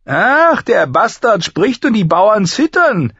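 very high, loud sarcasm; sharp, cold boom. A narrator's reading. Master-at-arms mocks the bastard who spoke up, sharp and cold. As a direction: ring out with cutting scorn. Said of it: "dröhnte der Waffenmeister mit seiner scharfen, kalten Stimme".

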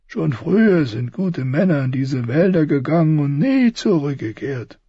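put-on high sceptical old soldier; doubtful, grim old man. Old Joren answers doubtfully.

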